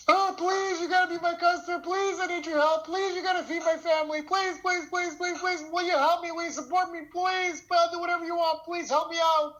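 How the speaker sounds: high-pitched, hammed-up tearful begging; crying plea. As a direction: imitate a man on his knees, pleading desperately in a high wail. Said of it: "he starts crying".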